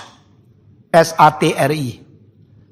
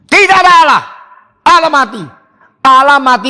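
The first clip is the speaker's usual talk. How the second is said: loud proclamation; 8 semitones higher, 5 dB louder, faster than usual. Real, not acted. Preacher proclaims Nietzsche's claim.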